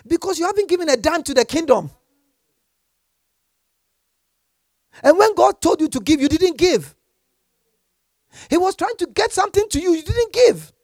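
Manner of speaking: high, loud preaching rebuke; preacher scolding stingy givers; fiery rebuke. Real, not acted. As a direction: scold the congregation from the pulpit.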